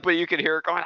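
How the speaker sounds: normal talking voice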